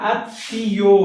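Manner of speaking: আত্মীয় slowly as "at-tio", ending on a clear o; final o sounded. Shown as a demonstration. Teacher showing why the last letter has no hasanta, final o sounded. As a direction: draw out the closing o of আত্মীয়. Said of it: "শেষে ওকার উচ্চারিত হয়েছে".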